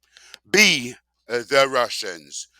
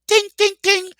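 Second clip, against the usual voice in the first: much higher sing-song ding ding ding; mock bell ding; 6 dB louder than usual. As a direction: chime it like a game-show bell.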